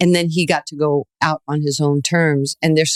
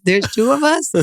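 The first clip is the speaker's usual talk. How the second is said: much higher, incredulous question; mock disbelief at a rival champion; amazed Ali; 10 semitones higher than usual. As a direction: Ali meets a ten-year-old rival, amused disbelief. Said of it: "he just looks at me and he goes".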